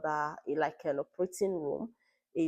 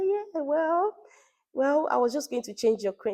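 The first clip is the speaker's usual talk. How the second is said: much higher, louder, hesitant voice; hesitant doctor; 10 semitones higher, 5 dB louder than usual. Retold or imitated. The GP hesitating over the allergy test, high and hesitant. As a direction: play the unsure elderly GP hedging. She was really hesitant.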